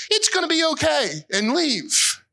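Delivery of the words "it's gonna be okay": awkward comfort. Retold or imitated. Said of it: "not say anything, but".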